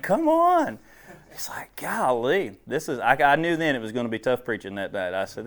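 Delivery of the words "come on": mock exasperation. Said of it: "I was like".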